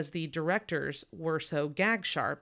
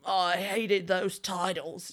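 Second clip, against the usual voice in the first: mock [name]; 3 semitones higher, slower than usual.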